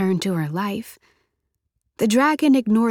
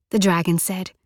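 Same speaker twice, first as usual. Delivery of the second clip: plain reading voice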